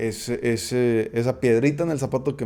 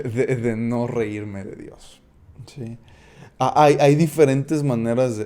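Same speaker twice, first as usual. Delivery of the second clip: ordinary delivery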